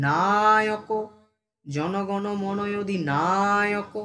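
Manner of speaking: "না" of "Naik" held long in a slow chant; drawn-out syllable. Shown as a demonstration. Teacher demonstrating the anthem's lengthening, "না" drawn out. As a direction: stretch "না" every time in "Naik". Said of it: "সেটাকে আমরা টান দিয়ে".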